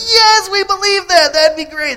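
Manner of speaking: high, loud, excited yell; cheering crowd. Acted. Crowd urging on a blindfolded walk, high excited shouting. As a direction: roar eager agreement as the crowd. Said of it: "And the crowd goes".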